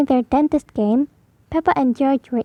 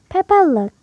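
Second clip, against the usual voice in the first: usual speaking voice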